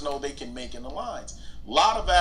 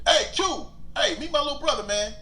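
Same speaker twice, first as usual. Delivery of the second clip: brother calling out; 11 semitones higher, 3 dB louder than usual. Retold or imitated. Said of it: "my brother went".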